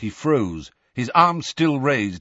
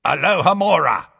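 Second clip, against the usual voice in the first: louder, raised spell cry; commanding incantation; 4 semitones higher, 5 dB louder than usual. A narrator's reading. Death Eater blasting the door open, commanding cry. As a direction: call out the spell forcefully.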